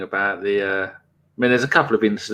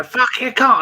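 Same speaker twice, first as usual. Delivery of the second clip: mate yelling at the telly, pitched way up; imitated furious yell; 12 semitones higher than usual. Mate screaming at the football on TV, high mock yell. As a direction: let rip like a fan screaming at the screen. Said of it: "shouting and screaming".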